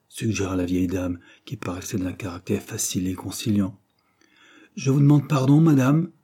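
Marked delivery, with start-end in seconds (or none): 4.8-6.1 loud, high objection; insistent contradiction, raised voice; retorting. A narrator's reading. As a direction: cut in with a firm correction. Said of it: "rétorqua Dobbs".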